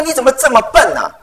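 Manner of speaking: a father's irritated outburst, retold; retold angry scolding. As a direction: snap at the clumsy child, replayed. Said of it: "我发脾气耶".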